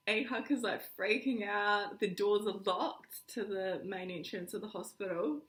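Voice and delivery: ordinary delivery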